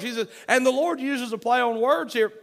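ordinary talk

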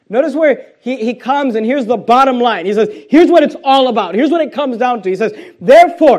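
emphatic preaching. Real, not acted. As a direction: drive toward the bottom line. Preaches toward the key verse.

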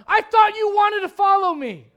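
loud, high shouted rebuke from God; God's voice. Acted. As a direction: thunder it as God calling out. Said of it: "And God's going".

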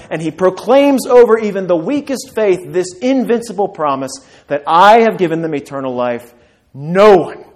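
loud, high, driving sermon voice; a pastor building to a climax; full-force preaching. Acted. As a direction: preach it out with rising fire.

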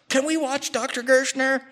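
put-on high pleading child's voice; whiny kid. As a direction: mimic a kid begging to watch. Kid's pleading question.

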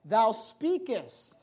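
"speakest" drawn out very slowly; emphasis on speakest. Shown as a demonstration. Preacher pointing out Job's rebuke to his wife, heavy emphasis on "speakest". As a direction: lean slowly on the word "speakest". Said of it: "notice, notice the emphasis".

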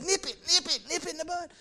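a hint of Barney Fife, high and jumpy; Barney Fife impression. Preacher quoting Barney Fife on nipping trouble, high mock voice. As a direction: squeak it out like a nervous deputy. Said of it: "like Barney Fife said".

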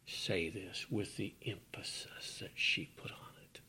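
soft hushed whisper; quiet reverent whisper. Acted. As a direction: lean in and whisper it. Hushed aside.

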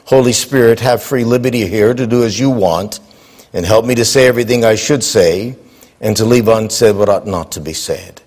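low, hushed prayer voice. Real, not acted. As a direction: pray humbly before the congregation. Opening prayer before conference.

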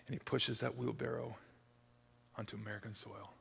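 very soft, low and slow; hushed countdown. A narrator's reading. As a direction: whisper the last steps. Final steps suspense.

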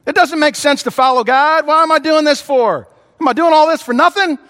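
rising despairing questions; anguished doubt. Retold or imitated. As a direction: voice a believer on the edge of giving up. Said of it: "what he meant by that is".

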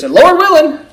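"Lord willing" tossed off fast and high; the casual shorthand "Lord willing"; quick abbreviation. Shown as a demonstration. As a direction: clip it to "Lord willing" like a habit. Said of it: "sometimes we just kind of abbreviate this".

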